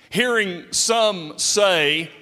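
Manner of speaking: ordinary talk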